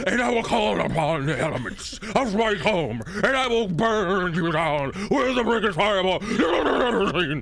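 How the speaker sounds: high, loud preaching wizard; enthusiastic pastor. Pretend. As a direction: preach fire and brimstone with zeal. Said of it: "a really enthusiastic pastor".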